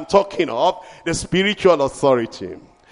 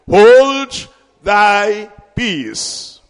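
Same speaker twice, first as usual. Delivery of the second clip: very slow, louder command; stern rebuke; 2 semitones higher, 4 dB louder, much slower than usual. Acted. Jesus rebuking the demon, very slow commanding. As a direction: rebuke with calm authority. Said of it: "Jesus rebuked him".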